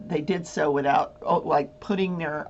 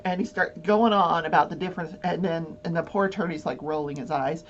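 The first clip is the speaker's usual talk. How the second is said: ordinary talk, nothing marked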